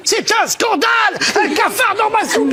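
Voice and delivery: loud, high fake indignation; mock outrage. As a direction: shout it as if scandalized.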